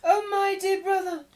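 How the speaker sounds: put-on high, loud shriek of a young woman; shrill public cry of feigned relief; screaming. A narrator's reading. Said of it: "a young woman screaming out very loudly".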